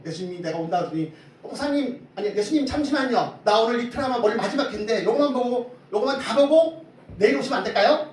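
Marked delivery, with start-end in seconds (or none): 1.4-8.1 high, loud comic bargaining; hammed-up flustered plea to hold on a moment; church lady haggling. Preacher imitating a deaconess asking Jesus to wait for her drama finale, comic pleading. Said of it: "옛날에 어떤 권사님이 그랬대요".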